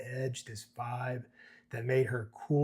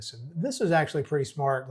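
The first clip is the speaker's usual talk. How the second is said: ordinary talk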